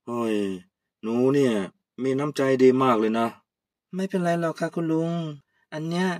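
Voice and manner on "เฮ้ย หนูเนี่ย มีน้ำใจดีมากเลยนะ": put-on low, moved old man thanking her; old uncle, touched. Old uncle thanking Kaew for the chili paste, lower.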